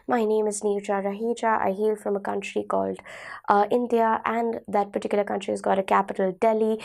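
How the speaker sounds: flat monotone with no intonation. Shown as a demonstration. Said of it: "ये एक तरीके की आवाज़ हो जाएगी जो फ्लैट है". (बिना उतार-चढ़ाव वाली सपाट आवाज़)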